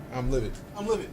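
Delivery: very soft, understated outrage; mock livid. Streamer fuming at his broken command, mock livid and hushed. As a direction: say it under your breath, pretending to be furious.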